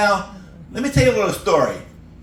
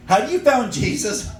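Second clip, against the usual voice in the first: higher, slow earnest question; earnest missionary kid; 7 semitones higher than usual. Retold or imitated. Missionary kid asking the store clerk, higher and slow. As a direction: ask it sweetly and slowly.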